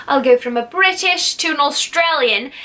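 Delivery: playful British accent sliding into Australian; lightly hammed-up British-to-Australian accent. Shown as a demonstration. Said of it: "I do accents pretty well".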